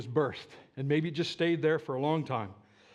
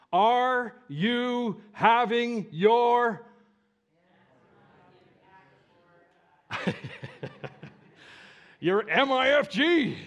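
fervent preaching question; 5 semitones higher, 5 dB louder, much slower than usual. Real, not acted. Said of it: "preaching climax, laughing".

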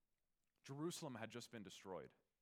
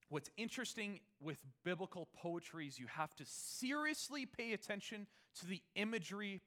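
normal talking voice

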